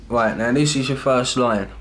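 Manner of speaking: ordinary talk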